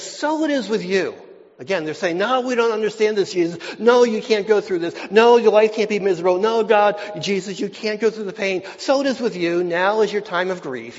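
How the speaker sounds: loud, driving pulpit preaching; full-force preaching. Acted. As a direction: preach it with a ringing, confident voice. Preaching Jesus and the disciples.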